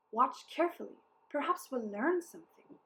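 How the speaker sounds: ordinary delivery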